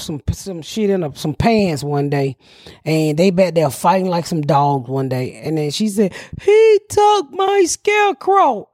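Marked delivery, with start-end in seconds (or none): 6.4-8.7 put-on high, slow, drawn-out kid wail; crying child. Child reporting her stolen scarecrow, high mock-crying whine. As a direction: imitate a little girl's tearful complaint. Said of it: "So she back there crying".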